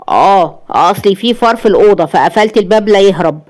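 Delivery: usual speaking voice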